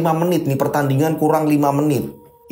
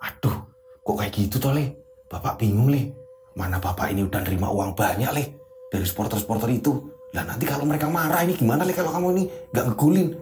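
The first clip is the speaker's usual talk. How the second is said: worried father; 5 semitones lower, 7 dB quieter than usual. Acted. Worried father fears supporters.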